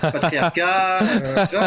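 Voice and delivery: slow, loud ironic drawl with a laugh; laughing mock approval; sarcastic. Mock praise of patriarchy.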